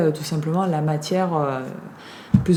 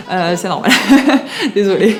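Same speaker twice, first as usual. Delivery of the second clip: laughing; 6 dB louder than usual. Real, not acted. Laughing at her own stuffy voice.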